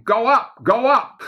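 high, excited mock scream; retold screaming. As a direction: cry it out high, imitating your own panicked yelling. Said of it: "I started screaming".

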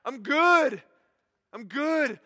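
hammed-up I'm fine to God; stubborn refusal. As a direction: people brushing off God. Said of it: "We're like".

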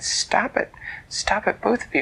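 put-on a girl breaking up a fight; Elise stepping in.

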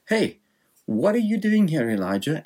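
a high, casual God calling out. Retold or imitated. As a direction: toss the question up high like a casual call. God voiced in casual paraphrase.

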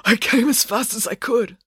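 high, breath-starved voice; struggling for breath as you explain; laboured breaths. Acted. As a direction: push the words out between laboured breaths. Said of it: "struggled to get the words out between laboured breaths".

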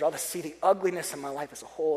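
ordinary talk

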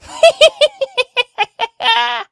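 put-on very high, loud old-woman cackle; long, piercing witchy laughter; shrill witch's laugh. A narrator's reading. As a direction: cackle shrilly for the whole marketplace to hear. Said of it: "Laughing so shrilly that all the marketplace".

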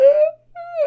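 high-pitched mock wail; imitated crying. Frightened girl in the thunderstorm, mock crying. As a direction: imitate a little girl's whimpering cry. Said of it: "this girl's crying".

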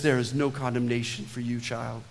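low, soft words of pardon; tender reassurance. Acted. Savior forgiving a child of God, tender. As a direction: let it sink low and gentle, quietest on the last word.

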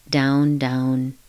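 normal talking voice

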